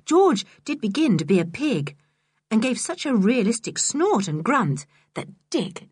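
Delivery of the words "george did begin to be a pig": usual speaking voice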